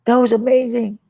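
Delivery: high, excited mock Jackie Chan praise; Jackie Chan imitation. Imitating Jackie Chan praising him after the stunt, high and excited. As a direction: squeal it up like an impressed Jackie. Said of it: "he go".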